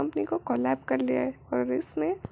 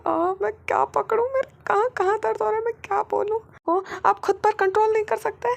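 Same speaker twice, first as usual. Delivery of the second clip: high, louder pained whining; flustered whine; 7 semitones higher, 5 dB louder than usual. Acted. Wing complaining to his husband about the pain, high and loud. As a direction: complain loudly, voice pitched up.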